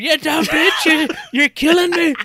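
high, loud panicked screaming; mock scream. Old man pinned by the truck, screaming high at the driver. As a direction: shriek as if being crushed. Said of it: "he's screaming".

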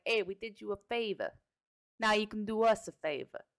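lightly put-on Italian accent; hint of an Italian accent; bad Italian accent. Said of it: "my Italian accent's horrible".

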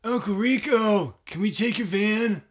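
put-on high, slow, breathy nerdy teen; Napoleon voice. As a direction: drag it out like Napoleon asking a favor. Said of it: "Good Napoleon, too. Very breathy".

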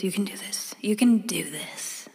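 whispered self-encouragement; whispered pep talk. Pretend. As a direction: whisper it to yourself, quietly. Pep talk before scary call.

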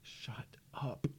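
very soft, voiceless whisper. Real, not acted. Host to co-host after a bad joke, whispered. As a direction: whisper it under your breath. Muttered reply to co-host's joke.

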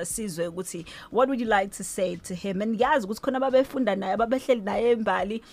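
usual speaking voice

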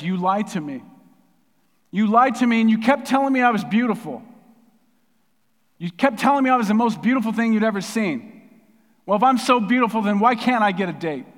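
high, loud acted outburst of hurt; angry, tearful. Daughter confronting her dad after being dumped, angry and tearful. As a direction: hurl the accusation as an upset daughter. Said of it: "she's angry, and she's crying".

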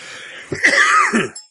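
a hard, high-pitched coughing fit; real cough. Real, not acted. Co-host coughs into the mic mid-answer, real coughing fit. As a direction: cough through a tickle in the throat. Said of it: "I had a little tickle in my throat".

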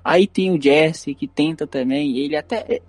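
ordinary talk, nothing marked

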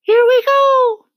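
very high, long, loud shout; excited cry. A narrator's reading. Miss Spider as the room lurches, very high loud cry. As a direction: yell it drawn out, right up high. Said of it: "cried Miss Spider".

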